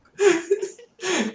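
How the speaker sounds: high, real laughter; shrieking laugh. Real, not acted. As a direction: crack up completely. Laughing along.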